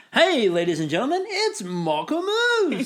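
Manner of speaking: hammed-up old cartoon host; 1930s cartoon announcer. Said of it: "sounds like a nineteen thirties cartoon hero".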